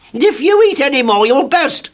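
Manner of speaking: high, loud bossy scold. A narrator's reading. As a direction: tell him off like a little bossy miss. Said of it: "said Miss Bossy to Mister Greedy".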